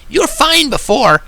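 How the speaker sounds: high, brash pony tease; Rainbow Dash voice. A narrator's reading. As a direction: laugh it off and coax a shy friend. Rainbow laughing, teasing friend.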